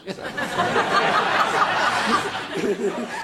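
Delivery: risa larga y alta; ríete fuerte; carcajada. Real, not acted. (carcajada larga)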